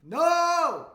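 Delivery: loud, high shout of denial. Real, not acted. Streamer yells at the podcast, loud high no. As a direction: yell no at the screen. Wrong numbers again.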